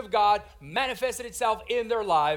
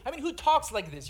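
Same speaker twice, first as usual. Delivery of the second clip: ordinary talk, nothing marked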